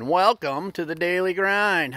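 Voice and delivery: slow, loud show opener; radio intro read; announcer intro. Acted.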